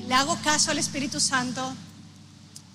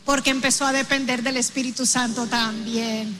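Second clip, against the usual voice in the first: fervent preaching; 4 dB louder than usual. Acted. Preacher driving home Jairo's change, fervent preaching. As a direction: build the congregation up with conviction. Sermon builds to loud preaching.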